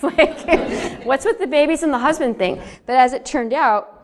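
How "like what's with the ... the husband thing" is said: higher, faster words bubbling with laughter; real giggling while talking; laughing. Real, not acted. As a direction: laugh through the disbelief. Retelling grandma's warnings, laughing.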